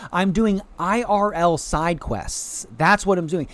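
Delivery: normal talking voice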